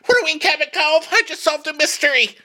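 very high, fluttery amateur-detective voice; hammed-up sleuth. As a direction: squeal the big deduction in mock triumph. Acts out a mock sleuth.

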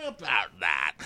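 quietly put-on "about that"; understated reply. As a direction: drop the voice for an awkward aside. Same reply, dropped voice.

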